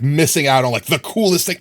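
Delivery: ordinary delivery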